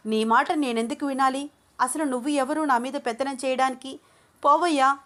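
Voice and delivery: high, loud, ending in a shout; woman furious at being ordered around; angry defiance. Acted. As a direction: push him off and yell. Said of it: "తన చేతులతో అభిరామ్ని తోసేస్తూ".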